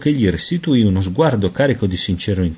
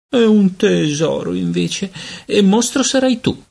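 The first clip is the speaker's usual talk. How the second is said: very high, indignant sister; furious retort; 11 semitones higher than usual. A narrator's reading. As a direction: fling the insult back in a high voice. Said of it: "Kate arrossì furibonda".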